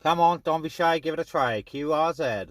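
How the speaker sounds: ordinary talk, nothing marked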